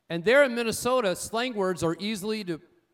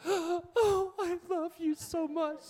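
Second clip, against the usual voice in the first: much higher, crying out in mock delight; gushing wife; 11 semitones higher, slower than usual. Husband voicing his wife's delight over the filled tires. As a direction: squeal it like an overjoyed wife.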